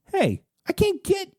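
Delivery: very high cry to the guards; acted yelling, pitched far above normal; high trapped yell. A narrator's reading. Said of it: "you yell at the guards".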